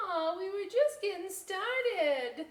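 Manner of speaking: high, slow whiny aw; grumbling kids. Acted. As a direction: complain about stopping. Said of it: "Everybody grumbled".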